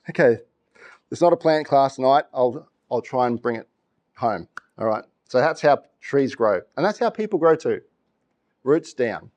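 usual speaking voice

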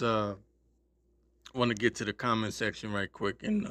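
ordinary delivery

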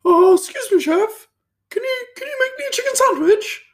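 mock posh falsetto, very high; really high-pitched voice. Front-of-house guy asking chef for a sandwich, high-pitched voice. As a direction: imitate a coworker's squeaky-high posh request. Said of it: "he just had this really high-pitched voice".

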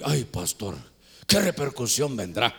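low, subdued mock worry; imitated apprehension. Congregant asking pastor about consequences, low and apprehensive. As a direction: sigh it out low, dreading.

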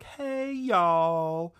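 high, drawn-out Southern greeting; Southern mom. Retold or imitated. Movie mom greeting visitors in the living room, mock Southern drawl. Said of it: "just starts speaking in a Southern accent".

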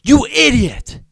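high, very loud self-scolding shout; yelling at himself. Retold or imitated. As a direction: berate yourself at full volume. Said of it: "he was yelling at himself".

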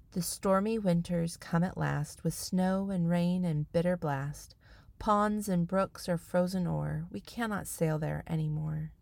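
soft, measured recital; a quiet poem for children; poem reading. Acted. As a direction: read the verses gently and evenly. Reads a poem.

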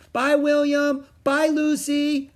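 high, loud farewell call; long-range high holler; shouted goodbye. A narrator's reading. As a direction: call goodbye across a distance, stretching the names. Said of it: "she shouted".

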